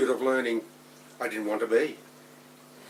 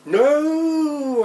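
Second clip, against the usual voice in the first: very high, loud, long-held howl; screamed protest; 13 semitones higher, 8 dB louder than usual. A narrator's reading. Child pulled along by mum to school, high drawn-out scream. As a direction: howl it out, then slide down. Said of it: "I screamed again".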